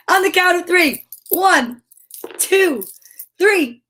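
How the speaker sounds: high, announced count to the reveal; excited countdown. Real, not acted. Host revealing vitamins to kids, high excited countdown. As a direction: build suspense with high, separated counts.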